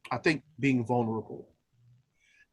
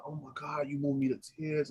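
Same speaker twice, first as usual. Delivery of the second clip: much softer, hushed quote of a moved colleague; soft imitated gushing; 8 dB quieter than usual. Colleague tells singer he moved them, softly imitated. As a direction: hush it, like a friend confiding. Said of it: "they said".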